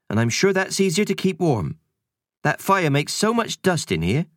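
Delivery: brisk housekeeper voice; busy cleaning lady shooing kids; Missus Kersey voice. Acted. Housekeeper fussing while dusting.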